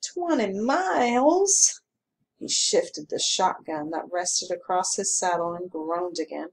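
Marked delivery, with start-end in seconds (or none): 0.0-1.8 slow, drawn-out dismayed question; dismayed groan. A narrator's reading. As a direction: stretch it out like a tired complaint. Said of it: "The constable groaned".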